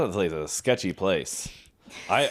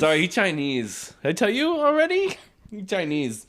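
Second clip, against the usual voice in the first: higher, hammed-up Japanese accent; mock Japanese accent; 8 semitones higher than usual. Sushi worker repeating excuse to customer, mock Japanese accent. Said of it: "I'll do the accent".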